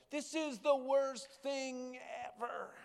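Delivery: tiny high bird voice, slow and despairing; high, slow little bird moaning. Acted. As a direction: squeak it out like a freezing little bird. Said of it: "and he said".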